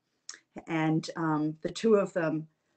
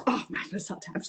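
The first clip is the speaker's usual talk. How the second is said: whisper; faster than usual. Retold or imitated. Imitating disgusted old man.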